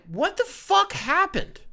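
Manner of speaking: high, slow, incredulous cry; stunned disbelief. Retold or imitated. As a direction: let it rise in stunned disbelief. Recalling daily disbelief at trade.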